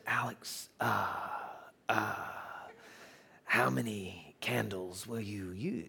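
quiet, slow, halting with drawn-out hesitations; Nick Costas. Retold or imitated. Club owner, as imitated, asks about candles, slow and halting. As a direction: imitate a soft-spoken man groping through his question. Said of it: "And he said".

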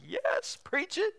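very high, softish squeal; imitating moms in a high-pitched voice; mock enthusiastic agreement. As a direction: cheer on the preacher in falsetto. Voicing mothers' enthusiastic agreement.

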